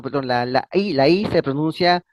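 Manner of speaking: usual speaking voice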